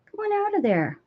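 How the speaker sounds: coaxing the cat out of the wool box, high. Real, not acted.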